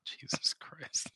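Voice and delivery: slow, breath-only whisper; exasperated whisper. Real, not acted. Co-host hearing about the rally turtle, whispered disbelief. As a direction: whisper it under your breath.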